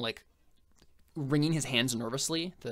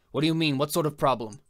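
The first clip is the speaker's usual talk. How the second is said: higher, much louder alarmed questions; alarmed Haitian boss; 4 semitones higher, 8 dB louder than usual. Acted. Haitian demanding answers from the guard outside, alarmed.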